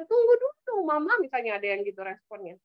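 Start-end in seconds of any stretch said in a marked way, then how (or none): 0.1-1.2 put-on child snapping back. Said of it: "anak udah mulai nih nadanya agak keras".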